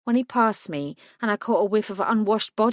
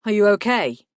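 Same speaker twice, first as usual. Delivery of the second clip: sharpened; 6 dB louder than usual. A narrator's reading. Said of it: "his voice sharpened".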